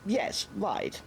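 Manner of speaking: a Major caught off guard, stammering agreement; flustered Major. A narrator's reading. Old Major answers the trooper, flustered.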